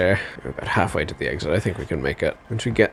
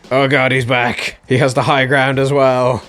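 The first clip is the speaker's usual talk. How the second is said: alarmed; 5 semitones higher, 6 dB louder than usual. Real, not acted. Bear reappears on high ground.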